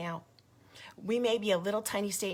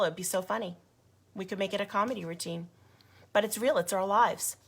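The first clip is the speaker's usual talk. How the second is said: ordinary talk, nothing marked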